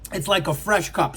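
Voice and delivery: talking right after a slurp; slurping coffee between words. Real, not acted. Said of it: "Sorry about slurping".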